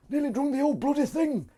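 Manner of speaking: very high-pitched put-on squeak; silly high voice. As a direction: pipe it up comically high. Repeats line in silly high voice.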